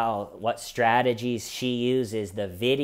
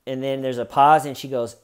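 ordinary talk, nothing marked

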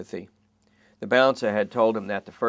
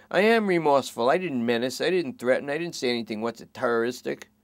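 higher, anxious bargaining voice; nervous junkie; 3 semitones higher than usual. Acted. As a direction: plead your case nervously. Lester worries about charges.

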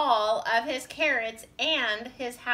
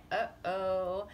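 small worried uh-oh; a lightly acted, uneasy whimper; mild whimper; 2 semitones lower, 4 dB quieter than usual. A narrator's reading. Tortoise, Bird and Squirrel facing more carrots, mildly whimpering. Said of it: "whimpered Tortoise, Bird, and Squirrel".

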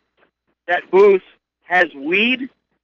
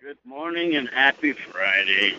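low, subdued greeting; unenthusiastic, low-key hello; not very happy; 5 semitones lower, 4 dB quieter than usual. Real, not acted. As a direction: wish them well without much cheer. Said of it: "You don't sound very happy".

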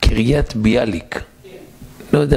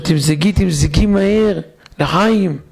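higher, slow and elated: pour, quickly, lechaim; a man bursting with joy calling for a drink; joyful toast; 6 semitones higher, slower than usual. Retold or imitated. Husband to his wife, elated high call to toast.

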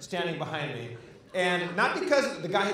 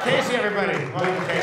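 loud, high send-off; announcing; 4 semitones higher, 8 dB louder, faster than usual. Real, not acted. Host thanks Casey before the crowd, loud announcing. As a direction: call out to the crowd for applause. Calling applause for audience member.

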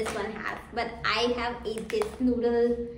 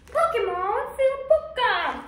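high, loud hype announcement; excited announcer shout; 11 semitones higher, 4 dB louder than usual. Shown as a demonstration. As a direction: yell the name like a game-show host. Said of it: "that was weird".

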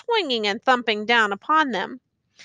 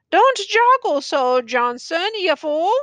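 peevish cry; 6 semitones higher than usual. A narrator's reading. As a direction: snap at the bearer like a hurt officer. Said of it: "he cried".

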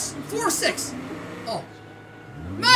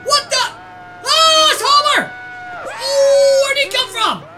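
loud, high burst of shock; startled shout; 6 semitones higher, 10 dB louder than usual. Real, not acted. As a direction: yell out in sudden alarm. Startled when Homer suddenly appears.

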